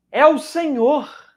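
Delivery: excited exclamation. Acted. John announces it is the Lord.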